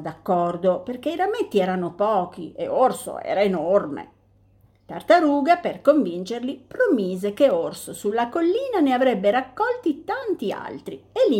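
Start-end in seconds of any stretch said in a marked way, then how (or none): none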